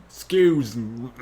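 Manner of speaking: slow, loud, drawn-out ask; slow drawn-out take. Shown as a demonstration. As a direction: stretch excuse out slowly.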